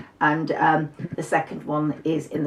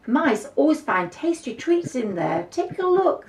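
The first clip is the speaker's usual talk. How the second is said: high, bright encouraging; eager boy; 10 semitones higher than usual. Acted. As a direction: urge the mouse on cheerily. Antonio voiced in story.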